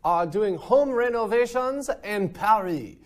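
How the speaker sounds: high, hammed-up French accent. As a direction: play the over-the-top French host. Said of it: "I'm gonna stop speaking in my French accent now".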